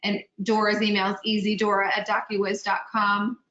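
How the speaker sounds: normal talking voice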